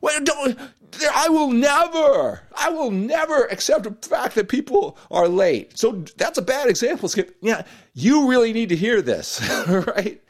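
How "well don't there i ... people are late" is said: emphatic outburst. Real, not acted. His own pet peeve outburst.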